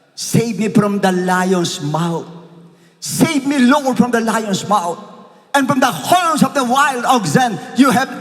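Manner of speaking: slow, high pleading psalm; preacher praying the psalm; fervent prayer. Acted. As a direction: cry it out to God.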